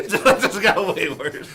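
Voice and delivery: high, loud, real laughing speech; cracking up while talking. Real, not acted. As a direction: slowly squeeze the words out through laughter. Both crack up at joke.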